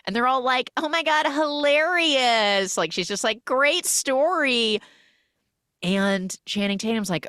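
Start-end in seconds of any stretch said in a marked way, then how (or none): 0.8-2.8 high, very slow mock enthusiasm; sarcastic praise. The group faking amusement at his story, high and drawn out. 3.4-4.8 high, very slow fake praise; sarcastic. She mocks the boring kid story, high and slow.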